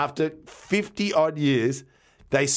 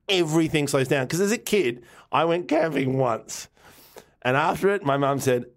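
ordinary talk, nothing marked

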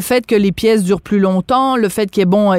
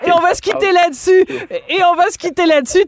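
laughing; 8 semitones higher than usual. Real, not acted. Host laughing after the bawdy line.

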